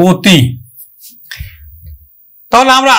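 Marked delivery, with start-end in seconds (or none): 0.1-0.4 অতি said "o-ti", opening on a rounded o; firm teacher's "o-ti" for অতি; closed O sound. Shown as a demonstration. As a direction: start অতি with o as in "open". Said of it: "আমরা উচ্চারণ করব ও".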